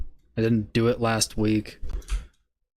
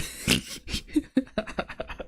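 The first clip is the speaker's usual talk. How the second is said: laughing; 13 semitones higher, 7 dB quieter than usual. Real, not acted. Laughing at tool.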